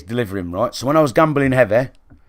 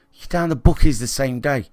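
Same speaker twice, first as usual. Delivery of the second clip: ordinary talk, nothing marked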